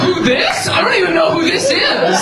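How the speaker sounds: very high, frantic feigned ignorance; mock denying cheater. Caught man denies knowing her, high mock bewilderment. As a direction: play dumb in a hammed-up high voice.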